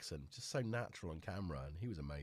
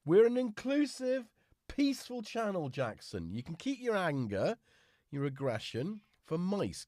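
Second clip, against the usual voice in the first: higher, louder mock-stern telling-off; mock scolding; 8 semitones higher, 7 dB louder than usual. Real, not acted. Owner mock-scolding Jackson for biting, high and loud. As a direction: scold the cat like a host.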